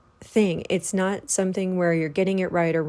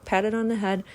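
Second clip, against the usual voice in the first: ordinary talk, nothing marked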